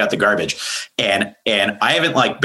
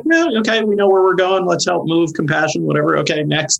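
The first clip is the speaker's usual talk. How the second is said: much higher, hurried mock of his therapy self; brisk, dismissive; 10 semitones higher than usual. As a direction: breeze past the feelings to the next item. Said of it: "I'm like, usually like".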